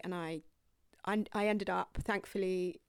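usual speaking voice

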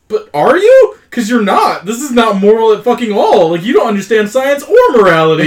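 high, loud mock outrage at the character; indignant jab at a movie villain; incredulous rant. Real, not acted. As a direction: tear into him, voice up high.

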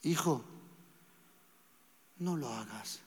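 gentle voice of the Spirit. Retold or imitated. The Holy Spirit gently warning his child, tender and soft. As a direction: coax a son gently, like a loving father. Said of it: "Su voz suave".

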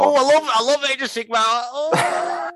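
high squealing fanboy gush; mock superfan. Mocking Brendan as a squealing, high-pitched superfan. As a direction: gush like a giddy fan, way up high.

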